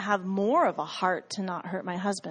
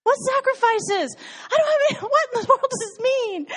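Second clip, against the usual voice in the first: baffled outburst; 5 dB louder than usual. Retold or imitated. To the Lord over a puzzling verse, high incredulous laughing cry. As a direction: squeak out the question in laughing confusion. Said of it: "I'm like".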